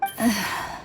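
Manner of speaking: a low, soft falling sigh. Acted. Atea sighing with relief after the cleansing, soft. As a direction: let out a tired breath. Patient recovering.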